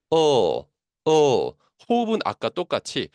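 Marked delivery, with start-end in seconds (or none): 0.1-1.5 "어" as a long dark L, tongue tip behind the front teeth; louder, held "어" with a touch of ㄹ mixed in; English L sound. Shown as a demonstration. Teacher demonstrates L for learners, "어" held and l-coloured. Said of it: "발음을 내주시면 돼요".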